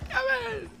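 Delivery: exaggerated mock call; hammed-up NPC call. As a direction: overdo the character's call for laughs. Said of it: "Why does he say it like that?".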